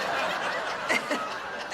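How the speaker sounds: airy laugh breaking through; can't hold back the giggle; breathless laugh. Real, not acted. Breaks up laughing describing tools.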